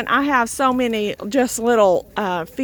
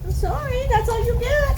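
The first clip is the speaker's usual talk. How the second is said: much higher, teasing pet voice; pet talk; 12 semitones higher than usual. Real, not acted. Owner teasing an animal kept out of the pen, high pet voice. Talking to animal blocked at gate.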